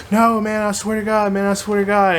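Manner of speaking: high, strained apology on the verge of crying; scared, near tears. Retold or imitated. Caught thief apologizing to the owner, frightened and high. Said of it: "freaked out, like, about to cry".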